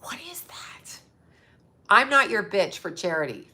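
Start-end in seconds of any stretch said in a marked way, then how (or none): 0.0-1.0 breathy unvoiced aside; a sudden whispered aside. Real, not acted. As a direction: drop to a whisper, scornful.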